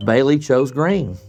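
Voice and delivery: plain reading voice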